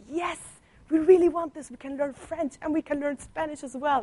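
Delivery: high, eager schoolkid cheer; excited classmates. Retold or imitated. Classmates thrilled about new language classes, high eager cheer. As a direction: bounce up high like a thrilled teenager.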